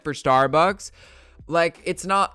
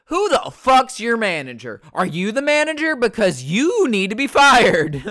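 pretend demand for manager; 8 semitones higher, 8 dB louder than usual. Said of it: "I would've fucking straight up been like".

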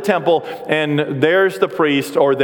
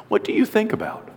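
normal talking voice

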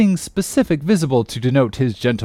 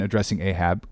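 ordinary delivery, nothing marked

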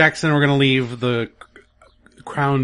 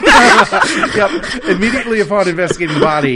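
laughing; 6 semitones higher, 6 dB louder than usual. Real, not acted. Cracking up at the twist.

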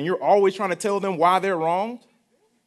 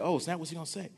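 hushed, slightly nervous inner voice; much softer, uneasy aside to himself; mock nervous; 11 dB quieter, faster than usual. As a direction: retell a worried thought under your breath. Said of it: "I got a little bit nervous".

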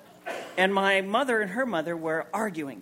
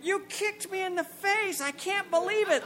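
mother's outrage; 9 semitones higher than usual. Retold or imitated. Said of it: "my mother stood up and went".

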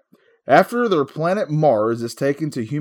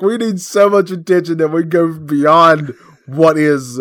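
ordinary talk

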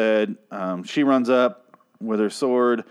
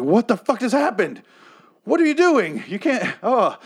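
high-pitched retold disbelief; incredulous outburst; 9 semitones higher than usual. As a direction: protest in a high, baffled voice. Retold shocked reaction to show.

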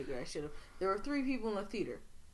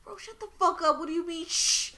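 loud retold yell; shouting; 10 semitones higher, 10 dB louder than usual. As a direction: yell it, fed up. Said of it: "I'm like".

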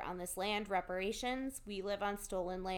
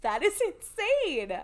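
amazed; 10 semitones higher, 7 dB louder, slower than usual. Real, not acted. Reacting to the bee stings.

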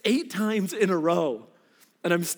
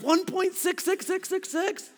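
slow, high drawn-out number; high slow nerdy emphasis; eager correction; 11 semitones higher than usual. Retold or imitated. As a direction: stretch out every six. Correcting dad's odds.

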